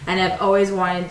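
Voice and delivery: usual speaking voice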